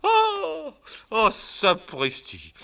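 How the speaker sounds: high loud slow outcry of dismay; dismayed cry. Acted. Man sees the hat stuck, crying out.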